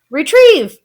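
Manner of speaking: high, loud command to a pet; boy shouting to his pet; crying out. A narrator's reading. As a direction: shout the order eagerly. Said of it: "cried Louis".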